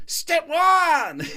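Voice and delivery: high, loud proclamation with a laugh; grand announcement. Retold or imitated. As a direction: announce step one like a revelation. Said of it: "with the most conviction I've ever seen".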